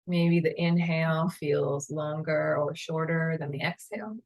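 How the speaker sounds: calm, unhurried meditation guide voice; guided meditation. Acted.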